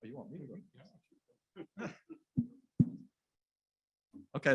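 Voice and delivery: ordinary talk, nothing marked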